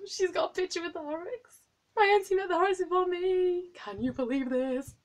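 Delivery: high, thrilled fangirl squeal; excited squeal. Real, not acted. Fangirl squealing that auntie met the skater first.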